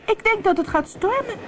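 very high little mermaid cry; alarmed small mermaid voice; calling out. A narrator's reading. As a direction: call it out up high. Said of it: "riep Melina".

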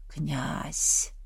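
low, hushed, distant call from the dark; ghostly whisper. Acted. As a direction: call him like a ghost in a nightmare. Ghostly voice calls in delirium.

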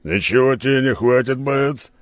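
hearty loud Skala. Acted.

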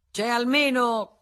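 high, loud, slow challenge; challenging. Acted. Senecal challenging the room, high, loud and slow. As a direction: drag it out, daring them. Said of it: "continuò Senecal".

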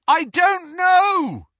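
high, loud, drawn-out shout; shouted answer. A narrator's reading. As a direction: yell it back slowly over the engine.

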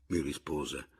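plain reading voice

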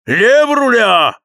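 high, slow, loud command; shouted order. A narrator's reading. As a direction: roar the order into the wind. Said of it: "ворчал Кэп".

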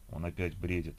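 usual speaking voice

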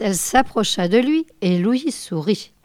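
ordinary delivery, nothing marked

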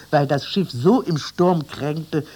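usual speaking voice

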